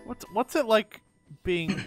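usual speaking voice

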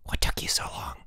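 a hushed, fretful question; no voice, just breath; whisper. Acted. Boots in the dark bushes, whispering to his friend. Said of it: "he whispered".

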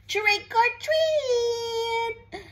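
high, slow, sing-song call; excited trick or treat call. Acted. Acting kids' call to toddler.

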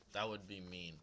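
normal talking voice

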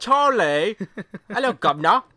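loud, high mock-British greeting; bad British accent. Joking over the children's book, hammed-up British accent. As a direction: hail him in a terrible British accent. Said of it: "my British accent's the worst".

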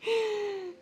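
high delighted gasp; playful gasp. Real, not acted.

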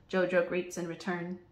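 ordinary delivery

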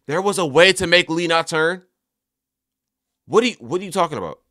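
loud, high-pitched disbelief; incredulous protest. Real, not acted. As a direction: protest as if you cannot believe it. Disbelief at the missed outcome.

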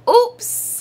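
high, sudden cry of dismay; high-pitched little exclamation; crying out oops. A narrator's reading. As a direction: squeal it like a caught-out kid. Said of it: "he cried".

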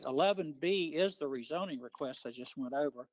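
usual speaking voice

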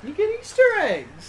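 teasing singsong. Real, not acted. Friend teasing the scared player.

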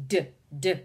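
"D" as two quick separate duhs; isolated D sound. Shown as a demonstration. Teacher confirms duck's first sound, "D" as separate duhs. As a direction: tap out "D" as a short duh each time. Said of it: "begins with the D".

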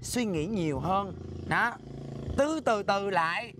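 very slow, low, drawn-out words; slow-down admonishing. Acted. Scolder telling a hot-headed rival to slow down, drawn-out admonishing. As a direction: counsel calm by stretching each word.

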